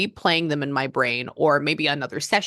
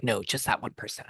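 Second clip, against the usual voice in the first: whispered quote; 5 semitones lower, 8 dB quieter than usual. Retold or imitated. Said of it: "Some people are just like".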